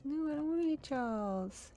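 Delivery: high, drawn-out mock refusal; playful whiny protest. Player refusing to eat Charles, mock whine. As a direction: plead it slowly, hammed-up and high.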